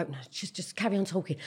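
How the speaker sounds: low, very soft aside; imitated discreet undertone; embarrassed hush. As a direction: murmur it low to cover the embarrassment. Embarrassed parent hushes friends.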